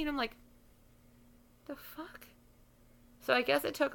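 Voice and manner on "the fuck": barely audible, bewildered aside; baffled hush. Retold or imitated. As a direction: say it tiny, in stunned confusion. Her baffled reaction to fans.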